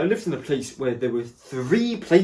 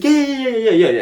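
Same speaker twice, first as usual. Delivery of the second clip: much higher, louder rapid yeahs; eager yeahs; 10 semitones higher, 8 dB louder, faster than usual. Retold or imitated. Staff eagerly agreeing to tonight's slot, loud high yeahs.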